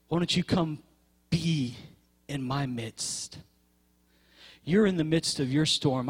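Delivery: Jesus inviting the listener out of the storm; tender calling. Acted. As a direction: call them gently, like Jesus inviting. Voices Jesus calling softly.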